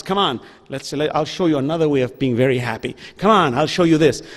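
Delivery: a hint of a low, coaxing Shaitan; tempting devil voice. Shaitan coaxing a person toward sin, lightly voiced low.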